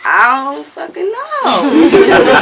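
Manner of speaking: high, drawn-out wail breaking into laughter; retold overwhelmed outburst. Singer acts out crying before her idol, laughing wail.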